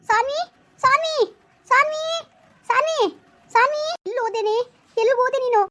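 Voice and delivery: put-on high, stretched calls of the girl's name; calling out. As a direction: call her name across the park. Mother calls for missing girl. (ಮಗಳ ಹೆಸರನ್ನು ದೂರಕ್ಕೆ ಕೂಗುವುದು)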